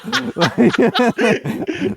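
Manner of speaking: high laugh. Real, not acted. Laughing at own joke.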